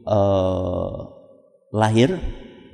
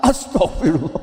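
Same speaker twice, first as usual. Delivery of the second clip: suami kaget; 3 semitones lower than usual. Acted. Suami kaget mendengar bayinya cacat.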